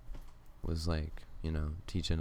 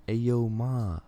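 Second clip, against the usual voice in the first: raised, higher-pitched mock call-out; imitated yell; 5 semitones higher, 5 dB louder than usual. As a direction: call it out like a brash pickup line. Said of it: "just yells".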